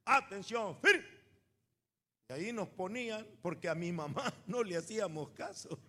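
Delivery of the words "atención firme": mock drill command. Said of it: "estaba militarizado".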